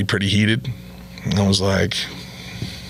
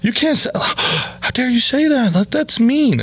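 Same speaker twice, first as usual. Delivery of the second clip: whiny protest; 13 semitones higher than usual. Retold or imitated. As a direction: sound like an offended comic whining. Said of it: "the guys were like".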